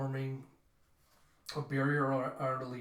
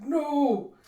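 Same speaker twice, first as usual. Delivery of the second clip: long, high, loud acted "no"; anguished shout; 12 semitones higher, 10 dB louder than usual. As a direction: cry out as a comrade falls. Voices cry as Dave is speared.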